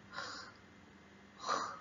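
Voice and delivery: خ as bare rasped kh with no vowel; sukun, held consonant. Shown as a demonstration. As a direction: hold خ alone, voiceless and soft. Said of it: "بنقف عند الحرف ونمسكه".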